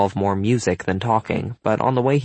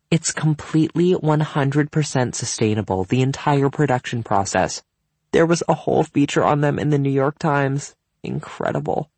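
higher hostess voice, gushing; enthusiastic hostess; 6 semitones higher than usual. A narrator's reading. Cynthia explaining the wine to Evan's mom, enthusiastic. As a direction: gush about the product.

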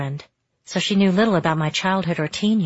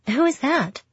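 higher, curious friend voice; 8 semitones higher than usual. A narrator's reading. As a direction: ask eagerly as the friend.

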